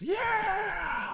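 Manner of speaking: high cheering yeah; excited cheer. Retold or imitated. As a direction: cheer it like a lone fan. Max cheers alone in chapel.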